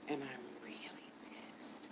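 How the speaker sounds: furious whisper like her mother; angry whisper. Retold or imitated. Playing her mother at the desk, an angry whisper. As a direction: hiss it out, seething but hushed. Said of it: "I pretended to be my mother 'cause she whispers when she's angry".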